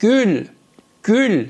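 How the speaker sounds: گل with jaw forward and rounded ü; Turkish gül. Shown as a demonstration. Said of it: "فک منو ببینید".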